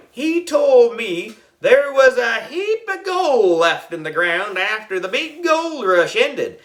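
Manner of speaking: high-pitched, slow country yarn-spinner; drawn-out backwoods character voice; folksy prospector. A narrator's reading. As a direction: spin a gold-rush tale like an excited old-timer.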